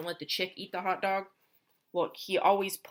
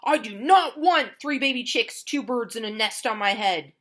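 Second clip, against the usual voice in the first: louder, higher fed-up complaint; complaining Elephant; 3 semitones higher, 9 dB louder than usual. Acted. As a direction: list the whole mess in exasperation.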